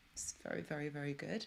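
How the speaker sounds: hushed, delighted whisper; playful whisper. Real, not acted. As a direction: whisper it with a grin.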